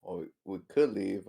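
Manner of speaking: ordinary talk, nothing marked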